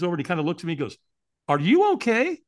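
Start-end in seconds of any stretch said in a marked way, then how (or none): 1.5-2.4 high, rising check on someone; lightly acted, raised-pitch concern; concerned stranger. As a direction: voice a stranger's pointed question, pitched up and louder. Stranger leans over, asks if okay.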